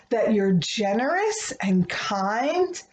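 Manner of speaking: ordinary delivery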